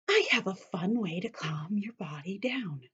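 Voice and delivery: soft secret whisper. Acted. Teacher whispering to kids about calming down. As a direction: lean in and whisper the promise. Teacher hushes before calm activity.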